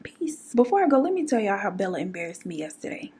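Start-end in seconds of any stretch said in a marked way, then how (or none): 0.0-0.5 breathy whispered peace; whispered sign-off. Real, not acted. Vlogger whispering peace to viewers as she signs off. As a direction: whisper it out as a goodbye. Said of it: "sign-off".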